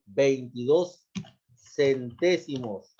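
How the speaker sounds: veintidós and centésimos drawn out slowly, each syllable clear; slow dictation. Shown as a demonstration. Teacher dictating the number slowly to pupils. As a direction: spell it out slowly for the class, leaning on the final ós of veintidós. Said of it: "lleva tilde en la O".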